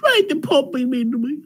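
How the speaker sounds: high fake-weeping whine; hammed-up crying complaint; mock crying. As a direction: whimper it like a wounded child. Mock hurt by the Pope.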